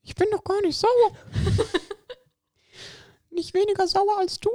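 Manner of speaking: very high squeaky lemon man. Acted. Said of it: "Du musst jetzt die Stimme verstellen".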